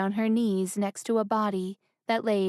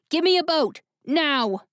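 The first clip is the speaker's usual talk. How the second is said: higher, louder urgent command; ordered; 6 semitones higher, 5 dB louder than usual. Acted. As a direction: shout the order. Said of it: "the prince ordered".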